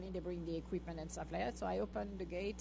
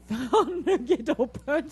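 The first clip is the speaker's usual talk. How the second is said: loud, drawn-out words through laughter; laughing; 5 semitones higher, 12 dB louder, slower than usual. Real, not acted. Owner telling how the dog found the open gate, laughing. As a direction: laugh it out while talking.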